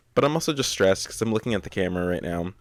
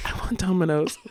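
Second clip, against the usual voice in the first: quoting a friend; 9 semitones higher than usual. Retold or imitated. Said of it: "Famous Tory quote".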